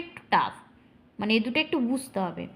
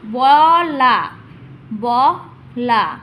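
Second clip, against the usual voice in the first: loud, slow, long vowels; broken into syllables; 2 semitones lower, 7 dB louder, much slower than usual. Shown as a demonstration. Teacher splitting the word twice, loud slow syllables. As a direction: push out each syllable separately. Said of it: "প্রথমে ভেঙে নেবে".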